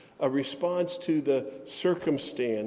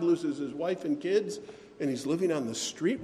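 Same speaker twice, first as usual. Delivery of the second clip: ordinary talk, nothing marked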